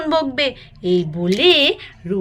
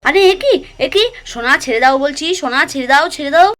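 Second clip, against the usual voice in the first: alarmed shock; 2 semitones higher, 6 dB louder, faster than usual. Acted. Family rushes in on the children fighting, alarmed. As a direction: burst in, startled and scolding.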